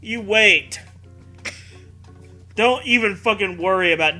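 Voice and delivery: normal talking voice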